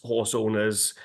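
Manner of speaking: ordinary delivery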